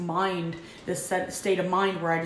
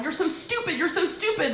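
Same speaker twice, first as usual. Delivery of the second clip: higher, mock yell at herself; self-scolding; 9 semitones higher than usual. She scolds herself for being late, in a mock yell. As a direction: berate yourself, a touch of a yell. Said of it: "like, yelling at myself".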